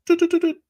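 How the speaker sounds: high, loud doot-doot beeps; piercing vocal beeps; electronic beeping. Acted. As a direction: imitate a gadget signal in high-pitched doots. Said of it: "and I go".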